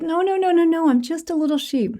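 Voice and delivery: high, timid begging voice; a small cub pleading with the big lion not to hurt him; pleading scared cub. Acted. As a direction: beg for your life like a frightened little cub. Cub insists he is a sheep.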